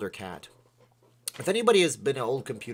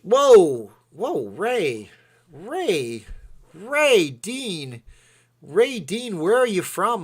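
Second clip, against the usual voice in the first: loud, higher excited calling out; calling out to a donor, surprised; 6 semitones higher, 8 dB louder, much slower than usual. Real, not acted. Surprised at donation.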